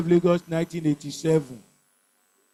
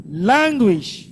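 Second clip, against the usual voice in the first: Languish with a strong, stretched "Lan"; stress on first syllable; 3 dB louder than usual. Shown as a demonstration. Teacher showing the class stress patterns, Languish stressed on "Lan". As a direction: lean into the opening syllable of Languish. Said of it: "First syllable".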